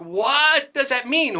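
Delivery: high, slow, baffled question. Retold or imitated. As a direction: draw it out, totally lost. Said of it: "And I'm like".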